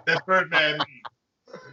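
usual speaking voice

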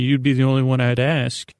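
normal talking voice

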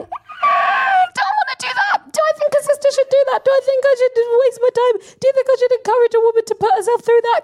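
very high, squeaky protest; comically high-pitched reluctant thought; squealed reluctance. Acted. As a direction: whine it high and fast, dodging the job. Voicing reluctant inner thought about a job.

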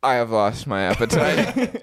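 low, slow, grave announcement; mock-serious low voice; imitating Sean. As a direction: declare it solemnly, drawn out and deep. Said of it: "and Sean's like".

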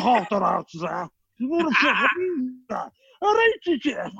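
hammed-up broad Scouse, high and fast; mock thick Liverpool accent, squeaky. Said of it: "No one in Liverpool could understand me".

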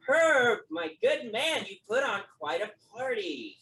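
a booming, high-pitched host greeting his friend; hearty nobleman. Acted. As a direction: throw your arms wide and greet him loudly. GM voices Lance greeting host.

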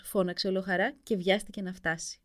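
ordinary delivery, nothing marked

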